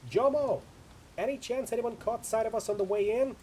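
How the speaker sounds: high, loud shout into a gale; shouting over wind. Acted. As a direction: call out hard to be heard over the storm. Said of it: "practically shouting to be heard over the howling wind".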